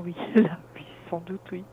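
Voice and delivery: normal talking voice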